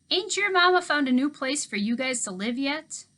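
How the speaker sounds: put-on easygoing old handyman. A narrator's reading.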